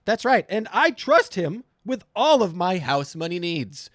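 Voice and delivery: ordinary delivery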